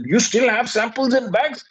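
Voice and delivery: high-pitched incredulous question; mock surprised captain. Captain doubting the traveller, incredulous. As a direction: raise the voice in disbelief.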